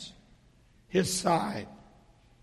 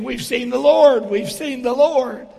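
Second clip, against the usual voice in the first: excited; 4 semitones higher, 7 dB louder than usual. Retold or imitated. Buddies excitedly telling Thomas.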